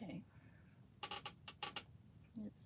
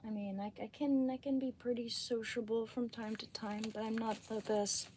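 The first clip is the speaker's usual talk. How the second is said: ordinary talk, nothing marked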